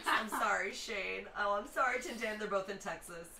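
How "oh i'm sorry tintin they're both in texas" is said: normal talking voice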